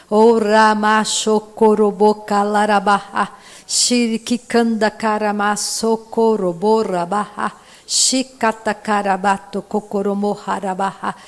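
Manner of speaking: fluent stream of glossolalia syllables; praying in tongues. Real, not acted. Preacher showing the congregation how, praying in tongues. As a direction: pray aloud in tongues, syllables running on.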